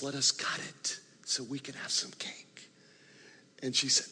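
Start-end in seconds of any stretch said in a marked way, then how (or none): 0.0-2.7 whispered, gentle and hushed. Retold or imitated. Friends whisper to weeping Agnes, gently hushed. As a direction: whisper so as not to upset her.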